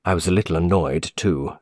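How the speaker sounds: ordinary delivery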